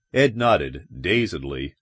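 ordinary delivery

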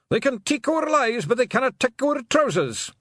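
very high, loud battle yell; war cry. Acted. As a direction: bellow it into the din. Pixie war cries in din.

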